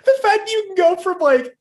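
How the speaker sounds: high, loud words through laughter; real squealing laughter in the voice; laughing hard. Real, not acted. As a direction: crack up while talking. Cracking up after imitated scream.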